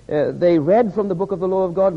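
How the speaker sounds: ordinary delivery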